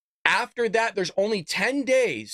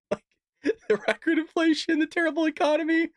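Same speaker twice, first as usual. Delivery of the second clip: laughing; 9 semitones higher than usual. Real, not acted. Host mocking Biden's record, laughing. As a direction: chuckle out the phrase.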